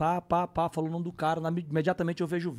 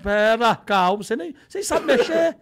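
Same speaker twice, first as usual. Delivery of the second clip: loud, high flustered arguing; hammed-up domestic squabble; retold quarrel; 11 semitones higher, 12 dB louder than usual. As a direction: yell back in comic exasperation. Arguing with wife, acted out.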